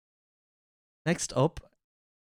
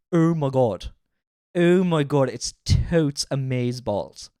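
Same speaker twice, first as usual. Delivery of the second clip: mocking Dublin D4, louder; Dublin D4 accent; 5 dB louder, slower than usual. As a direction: send up a Dublin D4 accent, drawn out at the end. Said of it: "accent I hate is the D four accent".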